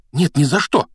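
high, loud refusal; sharp alarmed exclamation; exclaiming. A narrator's reading. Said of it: "воскликнула та".

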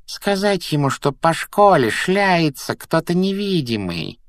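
high, slow scheming voice; high-pitched pondering poltergeist; Peeves, thoughtful. A narrator's reading. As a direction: muse aloud in a high, drawn-out voice. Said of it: "задумчиво проговорил Пивз".

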